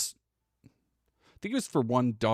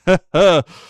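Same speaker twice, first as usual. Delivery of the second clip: loud burst of laughter; 5 semitones higher, 13 dB louder than usual. Real, not acted. Reacting to clip intro.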